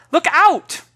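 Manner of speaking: very high, loud warning cry; urgent shout. Acted. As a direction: shout to save the mouse. Girl warns of mouse.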